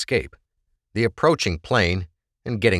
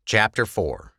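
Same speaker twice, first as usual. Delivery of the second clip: usual speaking voice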